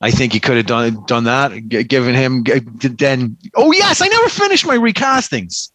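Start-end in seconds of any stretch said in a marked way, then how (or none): 3.5-5.7 sudden recollection. Real, not acted. Suddenly remembers.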